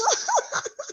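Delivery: sudden high laugh; high burst of laughter. Real, not acted. Laughs at own pidgin line.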